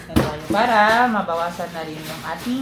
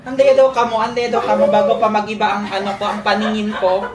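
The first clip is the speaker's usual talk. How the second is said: scolding shout; 2 semitones higher, 8 dB louder than usual. Real, not acted. Yelling at noisy coworkers.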